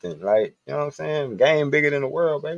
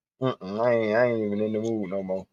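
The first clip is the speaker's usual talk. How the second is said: normal talking voice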